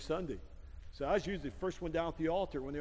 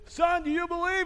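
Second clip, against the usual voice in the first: high, loud demand; mock insistent pastor; 12 semitones higher, 7 dB louder than usual. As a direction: shout it as an exasperated baptizing preacher. Pastor demands a third time.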